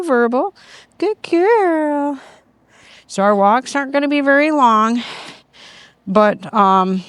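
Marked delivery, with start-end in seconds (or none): 1.0-2.2 high, drawn-out praise; high puppy praise. Real, not acted. Trainer praising Secret in a high, happy voice.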